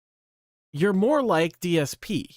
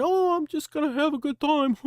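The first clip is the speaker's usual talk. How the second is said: high, airy mock of a carefree slacker; 7 semitones higher than usual. Ranting streamer mocks a lazy creator, high mocking voice. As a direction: sneer it out as a lazy guy's excuse. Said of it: "You don't just wanna sit there and".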